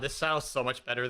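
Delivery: ordinary talk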